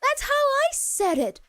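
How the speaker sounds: high-pitched, thrilled exclamation; excited outburst, pitched well up. Retold or imitated. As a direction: blurt it out in delighted recognition. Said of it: "and I was very excited".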